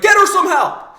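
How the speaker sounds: high, loud cry for help; acted alarmed yell. Gloriosa calling for help as Shocky faints, acted alarmed yell. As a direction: shout in hammed-up panic.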